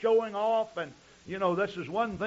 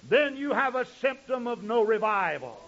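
normal talking voice

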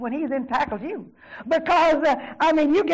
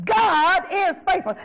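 high, slow, stretched proclamation; emphatic declaration; 7 semitones higher, 4 dB louder, slower than usual. Real, not acted. Preacher answering despair with the Word, emphatic declaration. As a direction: lift and draw out each word.

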